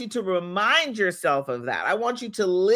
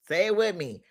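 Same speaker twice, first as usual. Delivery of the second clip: ordinary talk, nothing marked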